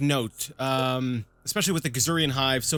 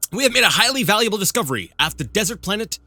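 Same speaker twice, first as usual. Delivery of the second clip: dramatic game text read; 3 semitones higher, 3 dB louder than usual. Acted. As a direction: read it like an epic announcement. Reads the game event text.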